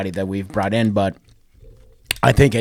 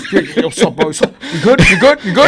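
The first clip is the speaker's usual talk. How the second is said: high, loud bro greeting, laughing; hammed-up bro checking in; bro hug greeting; 8 semitones higher, 8 dB louder than usual. Host mock-greeting Victor with bro hug, laughing.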